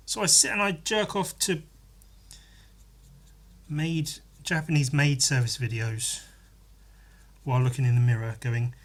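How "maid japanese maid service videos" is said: ordinary talk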